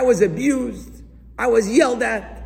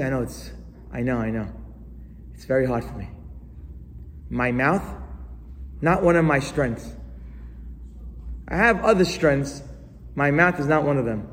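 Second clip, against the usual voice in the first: lower, softer, sheepish mumble; sheepish excuse; 6 semitones lower, 5 dB quieter than usual. Retold or imitated. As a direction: mumble the excuse like an embarrassed congregant. Congregant voiced making excuses.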